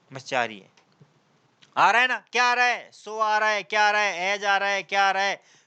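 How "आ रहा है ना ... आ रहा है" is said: ordinary talk, nothing marked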